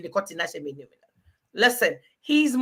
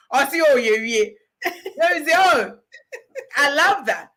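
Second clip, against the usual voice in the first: laughing; 5 semitones higher, 3 dB louder than usual. Retold or imitated. Quotes mum, laughing.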